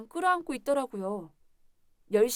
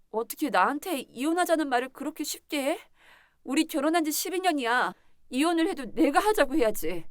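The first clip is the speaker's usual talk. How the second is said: higher-pitched, indignant questions building louder; protest, growing louder and more heated; outraged protest; 3 semitones higher, 5 dB louder than usual. Acted. Wife refuses husband's divorce demand, outraged and hurt.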